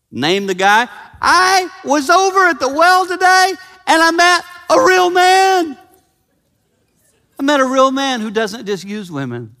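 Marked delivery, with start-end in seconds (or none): 1.2-5.8 very high, loud, gushing; thrilled woman. Acted. Woman telling her former husbands about Jesus, high and thrilled. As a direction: burst with news of a real man. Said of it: "walking around going".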